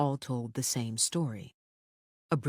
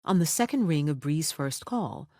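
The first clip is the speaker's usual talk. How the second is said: plain reading voice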